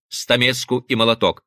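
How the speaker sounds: ordinary delivery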